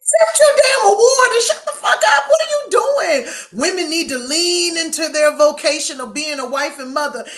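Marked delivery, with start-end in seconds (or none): 0.0-3.3 screaming high and loud at him; furious shout. Acted. Hosts ripping into the kicker, full furious shout. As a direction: yell it like you've lost it.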